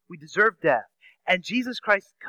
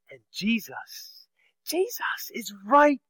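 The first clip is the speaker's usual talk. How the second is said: whisper; 4 semitones higher, 3 dB quieter, much slower than usual. Real, not acted. Dramatic reveal of Jesus.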